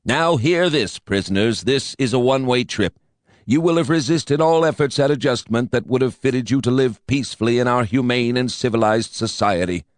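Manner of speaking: whiny, nasal recorded announcer; a smug, nasal loudspeaker drone; nasal whine. A narrator's reading. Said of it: "a repulsive nasal whine".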